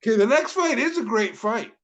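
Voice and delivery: getting hyped as he introduces the card; fired up. Real, not acted. Co-host fired up announcing the next great fight, excited. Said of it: "I'm getting fired up again".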